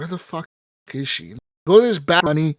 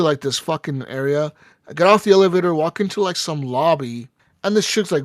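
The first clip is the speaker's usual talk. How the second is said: normal talking voice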